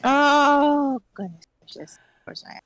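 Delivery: normal talking voice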